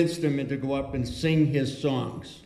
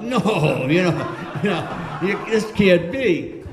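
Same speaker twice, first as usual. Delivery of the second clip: loud, high-pitched mock dismay; retold alarmed disbelief; 4 semitones higher, 5 dB louder, slower than usual. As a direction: cry out in comic disbelief, drawing it out slowly. Retold shocked reaction to TV news.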